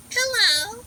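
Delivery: high, loud sing-song hello to a wasp; high playful hello to an insect. Real, not acted. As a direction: greet the wasp in a high lilting voice. Greeting a wasp.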